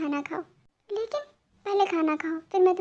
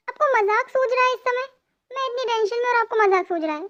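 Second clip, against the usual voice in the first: annoyed scolding; 6 semitones higher than usual. Acted. Girl scolds him for joking.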